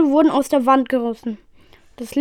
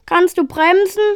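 higher, pressing question; urgent question; 5 semitones higher than usual. A narrator's reading. Passenger asks pilot mid crash landing, urgent. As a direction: ask urgently, pitched up.

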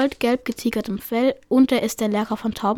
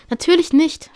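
louder, higher angry snap; hissed retort; 5 semitones higher, 5 dB louder than usual. Acted. Taubenpfote snaps back at the mocking cat, angry. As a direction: spit it back, offended. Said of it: "fauchte Taubenpfote".

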